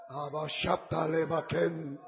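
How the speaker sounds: rising into chanted tongues; speaking in tongues. Real, not acted. Hablando en lenguas con música. (hablando en lenguas, cantado y en aumento)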